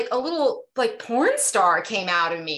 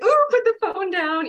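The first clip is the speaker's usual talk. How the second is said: teasing friends; 12 semitones higher, 4 dB louder than usual. Retold or imitated. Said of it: "And they're like".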